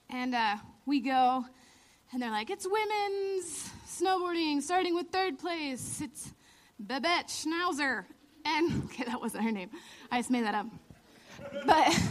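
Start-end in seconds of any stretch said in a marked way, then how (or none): 2.5-8.0 slow, higher mock announcer. Imitating the awards host calling third place, slow announcer voice. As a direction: draw it out like a ceremony host.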